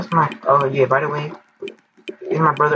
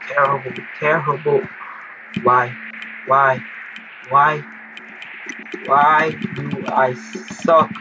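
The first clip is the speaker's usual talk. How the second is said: normal talking voice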